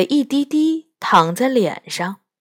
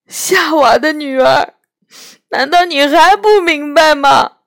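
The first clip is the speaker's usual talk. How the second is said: a lightly voiced tearful faun, louder; weeping faun; 8 semitones higher, 6 dB louder than usual. Faun confessing his wickedness to Lucy, tearful faun voice. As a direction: play the ashamed faun in tears.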